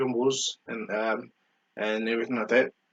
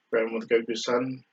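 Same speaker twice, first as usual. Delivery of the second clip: normal talking voice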